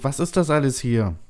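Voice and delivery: high, agitated question; panicked cry. A narrator's reading. Settler questions the dark capsule, crying out in panic. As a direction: cry it out, demanding answers. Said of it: "schreist du in die Dunkelheit".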